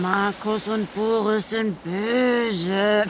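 mocking repeat. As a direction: drag it out to ridicule her. Mocking Sandra's claim.

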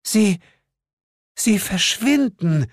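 high, halting, slow disbelief; slow high-voiced wonder; stunned amazement. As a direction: falter in astonishment at the sight. Same stunned woman, halting.

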